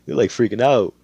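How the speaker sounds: ordinary delivery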